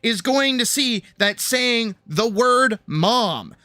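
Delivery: excited gushing. Real, not acted. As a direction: savour every word, thrilled and amazed. Gushing over the demon's trick.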